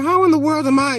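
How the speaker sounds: ordinary talk, nothing marked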